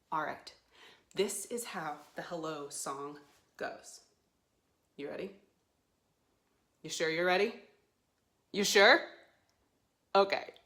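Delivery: usual speaking voice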